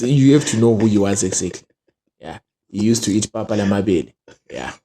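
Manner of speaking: ordinary talk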